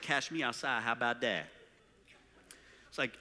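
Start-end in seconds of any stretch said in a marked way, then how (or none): 0.0-1.5 imitated street accent, "Cash" for catch; mock teen street accent, slurring the phrase. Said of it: "this street accent thing".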